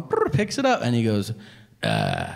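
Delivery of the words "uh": low flat "uh" grunt; dull grunt. Retold or imitated. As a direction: grunt instead of hello. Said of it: "answers the phone with a noise".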